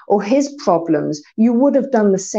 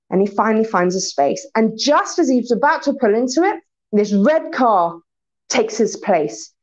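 ordinary delivery

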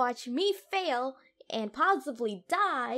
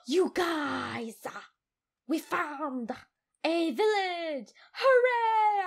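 excited cheer; 5 semitones higher, 7 dB louder, much slower than usual. Real, not acted. Discovering a village.

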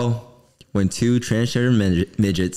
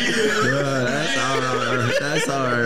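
laughing; 5 semitones higher, much slower than usual. Real, not acted. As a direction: crack up while saying it. Laughing reaction to joke.

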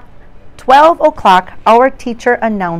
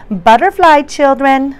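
teacher's goodbye; 6 semitones higher, 5 dB louder than usual. Acted. Teacher says goodbye at door.

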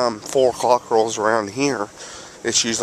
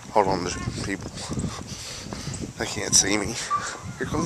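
hushed; 3 semitones lower, 9 dB quieter, faster than usual. Real, not acted. People nearby, hiding from them.

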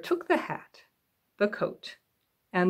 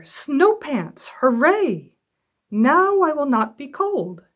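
loud, much higher hooray, then slow; excited cheer; 7 semitones higher, 6 dB louder, slower than usual. Acted. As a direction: cheer like a happy cub. Little Bear cheers.

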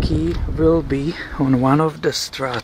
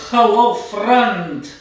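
slow, higher, hammed-up Russian accent; mock Russian accent; 3 semitones higher, slower than usual. Imitating a Russian delivery driver's voicemail in a Russian accent. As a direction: drawl it out in a put-on Russian accent. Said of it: "heavy Russian accent".

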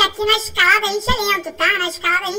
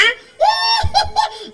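high laughing shout; excited laughing cry; laughing squeal; 14 semitones higher than usual. Real, not acted. Sudden game boost.

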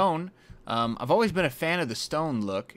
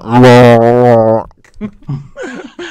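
long distorted garbled noise; mock the broken mic sound loudly; imitated glitch noise; 17 dB louder than usual. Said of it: "you were like this on".

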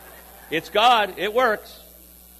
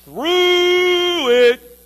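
high, loud, stretched-out through; long drawn-out wail; 11 semitones higher, 11 dB louder than usual. Acted.

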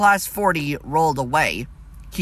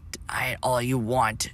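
quiet unvoiced taunt; the spectral engine whispers a taunt; ghostly whisper; 2 semitones lower, 6 dB quieter than usual. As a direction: hiss it softly from the shadows.

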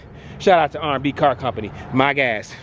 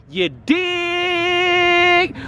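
long high drawn-out call; drawn-out catchphrase. Acted. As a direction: stretch it like a catchphrase.

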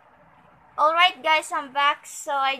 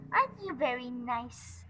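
ordinary talk

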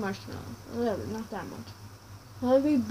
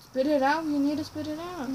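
cheeks stuffed full; 3 semitones higher than usual. Real, not acted. Marshmallows stuffed in mouth.

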